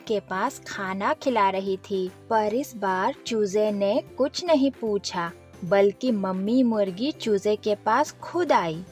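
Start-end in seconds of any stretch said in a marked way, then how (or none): none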